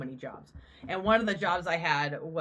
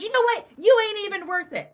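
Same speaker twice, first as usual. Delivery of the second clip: high, loud dismissive mock; the attacker backing off, high and loud scorn; scornful woman; 13 semitones higher, 9 dB louder than usual. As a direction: sneer it in her high voice. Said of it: "but she goes".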